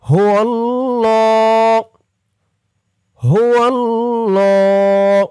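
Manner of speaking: slow, chanted tajweed recitation; Quran recitation. Shown as a demonstration. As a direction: recite it melodically for students to follow. Teacher recites verse again.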